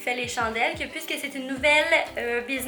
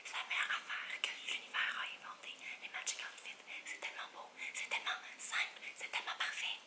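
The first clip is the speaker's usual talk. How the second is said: breathy excited whisper; 23 dB quieter, faster than usual. Real, not acted. Whispering her love of matching outfits to viewers. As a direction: whisper the gushing like a secret.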